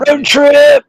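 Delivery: high, excited whoop of a shout-out; excited cheer. Real, not acted. Cheering the road trip with friends, excited high cheer.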